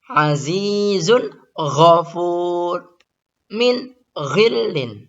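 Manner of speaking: "Azizun ghafur" slow and high; slow recitation. Shown as a demonstration. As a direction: clear tanwin before gha. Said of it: "Bertemu dengan gha". (tanwin jelas pada "Azizun ghafur")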